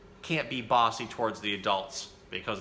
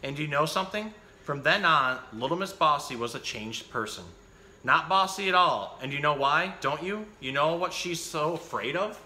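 put-on lively picture-book reading for children; animated bedtime-story reader; story narration; 3 semitones higher than usual. A narrator's reading. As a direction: read the story out with bouncy, emphatic phrasing.

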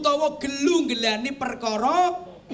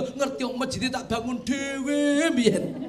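ordinary talk

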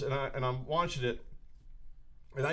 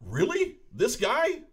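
incredulous wife; 9 semitones higher, 4 dB louder, much slower than usual. Retold or imitated. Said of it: "She's just like".

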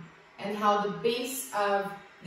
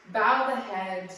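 ordinary talk

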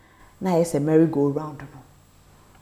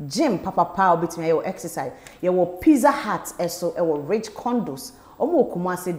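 ad read; 4 dB louder than usual. Acted. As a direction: hype the apartments to buyers. Apartment ad read.

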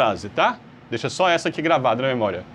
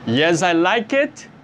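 slow model answer; much slower than usual. Shown as a demonstration. Teacher giving the correct answer slowly to students. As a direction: slowly model each word. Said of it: "Não".

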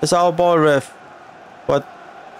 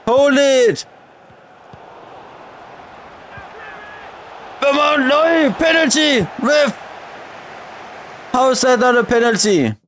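screaming for a penalty; 6 semitones higher than usual. Real, not acted. Fan appealing to the referee for a penalty, outraged scream. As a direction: yell at the referee as if he can hear.

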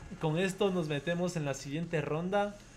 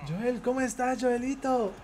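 put-on high, sing-song voice for a little kid; cooing greeting; 7 semitones higher than usual. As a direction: coo at the kid like talking to a child. Saluda al niño con voz aguda. (saludo meloso, voz muy aguda para un niño)

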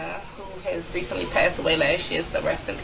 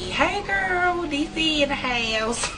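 hyped-up DC greeting; 8 semitones higher, slower than usual. Pretend. Greeting Khadijah from DC.

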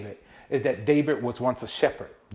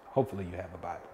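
softer, lower and fast throwaway line; quick aside; 7 semitones lower, 10 dB quieter, much faster than usual. Real, not acted. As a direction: toss it off under your breath. Quick hopeful aside to youth.

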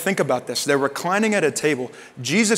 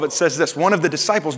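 ordinary talk

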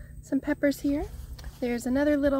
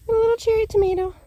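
high and quick with delight; 10 semitones higher, faster than usual. Real, not acted. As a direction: coo over a tiny find. Finding the lost cherry tomato.

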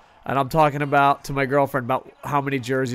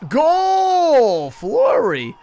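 long high held goal cry; goal shout; 12 semitones higher, 7 dB louder, much slower than usual. Real, not acted. Streamer celebrating a game goal, loud shout. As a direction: call the goal like a fan.

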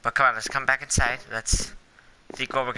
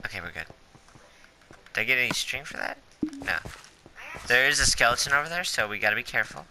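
usual speaking voice

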